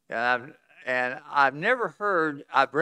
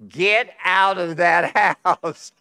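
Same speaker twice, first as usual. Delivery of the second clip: higher, louder, slow, laughing through it; mock urgent order; 7 semitones higher, 10 dB louder, much slower than usual. Missionary on the phone warning him, laughing mock yell. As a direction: drawl out the warning half-laughing. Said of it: "he says".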